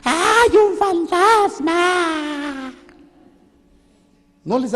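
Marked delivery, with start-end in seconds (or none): 0.0-2.7 high, drawn-out panicky shriek; shrieking like old women. Retold or imitated. Preacher mocking fishermen screaming at a ghost, shrill. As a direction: cry ghost like a frightened old woman. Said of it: "empiezan a gritar como viejas".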